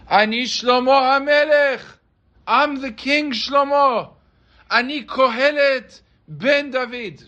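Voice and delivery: high, loud, slow cry of a king nobody believes; desperate scream. Acted. Beggar king screaming his name to Eretz Yisrael, high loud cry. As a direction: shout it to the whole land, pleading to be known. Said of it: "he starts screaming on top of his lungs".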